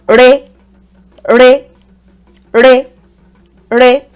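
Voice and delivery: "re" held on a level middle pitch. Shown as a demonstration. Teacher contrasting the re tone with do. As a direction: say "re" one step up and level. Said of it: "it's in the middle".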